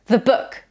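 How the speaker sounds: the said fast with a short schwa, thuh; the book, with the rushed to a schwa; weak form the. Shown as a demonstration. Teacher showing the unstressed form, quick schwa. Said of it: "say it very quickly and with a reduced vowel".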